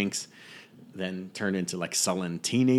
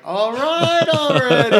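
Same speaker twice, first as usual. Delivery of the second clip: mock Han Solo reply; 4 dB louder, much slower than usual. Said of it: "And Han's like".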